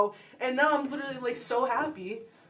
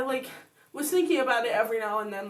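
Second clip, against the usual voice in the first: ordinary talk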